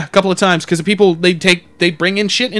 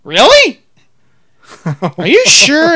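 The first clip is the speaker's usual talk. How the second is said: high, loud mock astonishment; doubting crazy lady; 8 semitones higher, 6 dB louder, slower than usual. Crazy lady told it isn't her truck, high mock surprise. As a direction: squeak it in exaggerated disbelief. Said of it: "steps back and goes".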